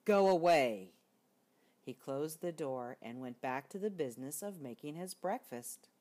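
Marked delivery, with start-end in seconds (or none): 0.1-0.9 loud grumpy order; gruff bear dismissal. Acted. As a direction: shoo him off loudly. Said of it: "Bear said".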